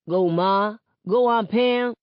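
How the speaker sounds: loud, rising cheer from the crowd. A narrator's reading. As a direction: egg the dancers on at full voice. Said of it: "cheer them on".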